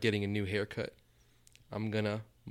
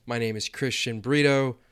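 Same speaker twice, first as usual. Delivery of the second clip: usual speaking voice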